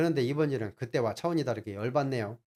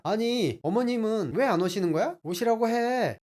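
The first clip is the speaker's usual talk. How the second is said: higher, louder angry demand; wife yelling; 8 semitones higher, 5 dB louder than usual. Retold or imitated. Wife yelling at husband to invite mother-in-law. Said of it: "화를 내면서 소리를 지릅니다".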